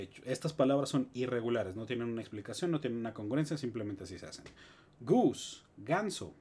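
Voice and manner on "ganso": usual speaking voice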